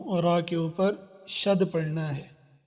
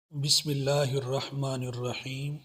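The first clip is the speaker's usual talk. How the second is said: recitation; 3 semitones lower, 4 dB quieter than usual. Shown as a demonstration. Opens recitation softly.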